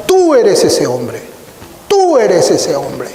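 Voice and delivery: slow, pointed accusation on tú; accusing prophet. Retold or imitated. Prophet Natán accusing David, slow and pointed. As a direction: stress "Tú" slowly, like pointing a finger. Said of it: "lo señalara con su dedo y le dijera".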